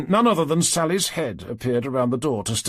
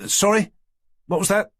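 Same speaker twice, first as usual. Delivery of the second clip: sudden high startled question; 10 semitones higher, 5 dB louder than usual. Acted. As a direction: caught off guard, jumps in. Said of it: "looking up with a start".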